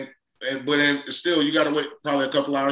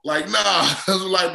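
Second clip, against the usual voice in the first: usual speaking voice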